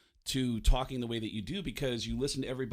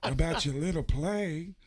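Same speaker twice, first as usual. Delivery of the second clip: louder, mocking Pacino, last line slow; Al Pacino impression; 6 semitones higher, 3 dB louder, slower than usual. Pacino mocking Baldwin's play, in a Pacino impression. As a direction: sneer at the fruity little play. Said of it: "And he goes".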